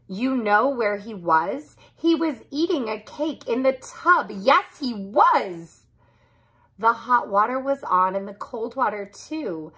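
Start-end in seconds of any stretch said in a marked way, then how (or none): none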